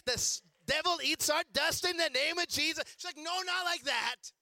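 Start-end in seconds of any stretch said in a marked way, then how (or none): 0.1-2.8 mock fire-and-brimstone praying, rising in pitch; hammed-up warfare prayer, building; militant prayer. Acts out his militant prayer. 3.2-4.3 high, slow mock reproof; drawn-out imitation of mom objecting; mom correcting. Mom stops his prayer.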